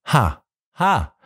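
a short mocking ha ha, lightly voiced; read-aloud scornful laugh. A narrator's reading. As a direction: laugh it off with contempt. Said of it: "lachte sie".

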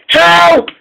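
high-pitched storybook cry for help. A narrator's reading. James dangles off the rails, crying for help. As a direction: call out for help, acted, not screamed. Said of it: "he cried".

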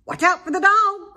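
high, loud sheep warning; high loud sheep cry. A narrator's reading. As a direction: bleat the warning. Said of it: "cried the sheep".

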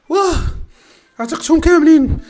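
ordinary delivery

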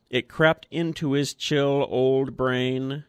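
ordinary delivery, nothing marked

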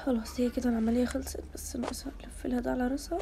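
hushed whisper over the patient. Acted. Doctor whispering while operating. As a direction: whisper so the patient isn't disturbed. Doctor finishing surgery quietly.

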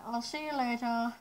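muffled behind face mask; speaking inside respirator mask; through a gas mask. Real, not acted. Seen 5: Speaker wears full gas mask over face throughout all frames.

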